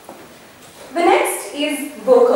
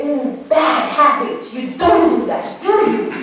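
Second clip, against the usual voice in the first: put-on talking to a child. Shown as a demonstration. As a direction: coo it gently like a parent to a little kid. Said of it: "To a child, you'd probably say".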